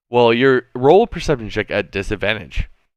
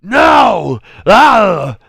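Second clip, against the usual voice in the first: high, very loud roar; shouting to bartender; 10 semitones higher, 9 dB louder than usual. Acted. Customer demanding beer right away, shouting. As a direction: roar the demand at full force. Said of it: "shout to the bartender".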